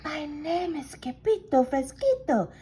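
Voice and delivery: meek soft mew. Acted. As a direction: quaver it out like a tiny scared pup. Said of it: "in a mew, mew, soft voice".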